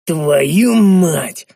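loud, angry swearing; angry curse. A narrator's reading. Narrator swears at the news of the quarrel, angrily. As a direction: curse it out with force. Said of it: "зло выговорил я".

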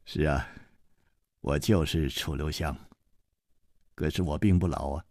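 low, soft, teasing reply; 楚留香 amused. Acted. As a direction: answer lightly with a smile. (楚留香低声调侃)

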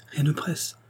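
high, soft reassurance; gentle girlish persuasion; coaxing reply. A narrator's reading. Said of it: "répliqua Marianne".

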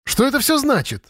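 put-on very high, girlish questioning; high-pitched demand. A narrator's reading. Lera demanding to know what happened, high-pitched. Lera's squealed demand continues.

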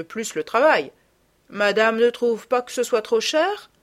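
a shopkeeper bowing to a client; deferential merchant. Acted.

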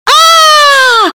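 loud, very high "aah" shriek; sudden cry. A narrator's reading. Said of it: "вдруг закричала она".

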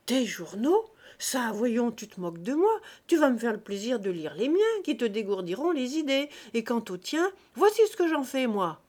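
loud, contemptuous rebuke; a domineering guest mocking his host's newspapers; indignant scolding. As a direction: berate him, then blast the last boast. Guest scolds host over newspapers.

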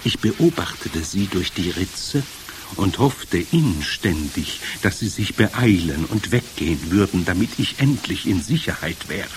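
plain reading voice

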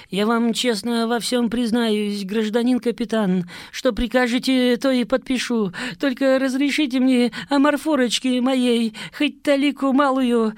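high, whiny slurred pleading; slurred begging. Acted. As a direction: beg with a tangled tongue. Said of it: "заплетающимся языком продолжал клянчить начпрод".

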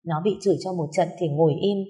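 usual speaking voice

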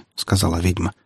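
usual speaking voice

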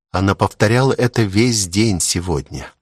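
plain reading voice

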